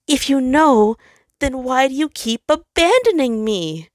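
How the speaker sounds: high, loud hurt plea; the sun pleading, high and pained; pleading star. Acted. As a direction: beg him not to leave again. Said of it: "the star pleaded".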